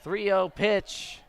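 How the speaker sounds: ordinary talk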